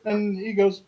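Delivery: normal talking voice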